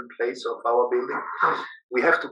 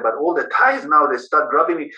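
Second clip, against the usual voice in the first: ordinary talk, nothing marked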